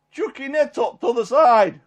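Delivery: retold loud call. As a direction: shout instructions across the water. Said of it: "he kinda shouts".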